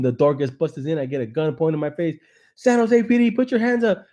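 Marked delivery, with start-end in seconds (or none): 2.6-4.0 loud, high police bark; hammed-up raid shout; cop shout. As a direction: yell it like a cop bursting in.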